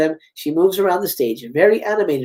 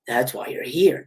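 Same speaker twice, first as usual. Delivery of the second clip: Ghostface; 5 semitones lower, 4 dB quieter than usual. Acted. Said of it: "getting a call from Ghostface".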